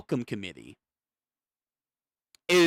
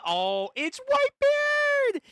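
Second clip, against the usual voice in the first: high, slow cry of surprise; stunned high exclamation of a character's name; shocked recognition; slower than usual. Real, not acted. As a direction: gasp it out like you can't believe who it is.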